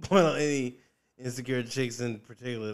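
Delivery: normal talking voice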